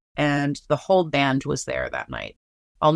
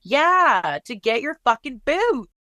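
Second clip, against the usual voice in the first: high, loud mock-exasperated yell; emphatic retort; 8 semitones higher, 4 dB louder than usual. Friend reminding her why they went back, loud teasing retort. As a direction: toss it back with a teasing jab.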